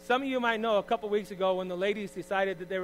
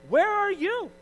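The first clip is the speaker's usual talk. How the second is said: much higher, mock-calling out to absent helpers; mock high exasperated call; 13 semitones higher, 8 dB louder, much slower than usual. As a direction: squeal it high like a mocking cry. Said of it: "and I'm like".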